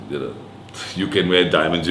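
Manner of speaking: ordinary talk, nothing marked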